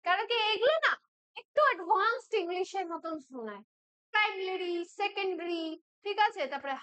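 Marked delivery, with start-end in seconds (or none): none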